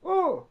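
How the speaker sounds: sudden high-pitched yelp of fright; mock panicked "Oh!". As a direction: squeal it out like a startled man. Mimicking the scared yelp again.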